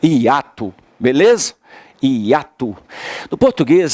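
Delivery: ordinary delivery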